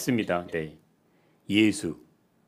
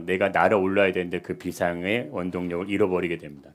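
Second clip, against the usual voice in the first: usual speaking voice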